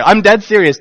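ordinary talk, nothing marked

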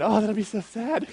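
laughing through a mock sad sigh; half-laughing fake sympathy. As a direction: pretend to be sad while giggling. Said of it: "she's imagining it".

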